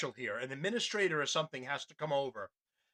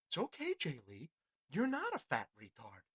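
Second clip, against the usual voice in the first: mocking soothing tamer; 3 semitones lower, 9 dB quieter than usual. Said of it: "he's gonna try and".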